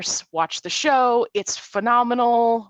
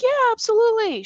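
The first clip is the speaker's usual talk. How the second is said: much higher, louder absolutely; an eager, warm agreement; enthusiastic yes; 5 dB louder, faster than usual. Retold or imitated. Shatner happily agrees to a handshake, high and loud. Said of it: "he was like".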